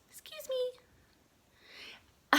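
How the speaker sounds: very high, very slow squeak; drawn-out squeaky little cloud; tiny drawn-out voice. Acted. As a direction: stretch it out tiny.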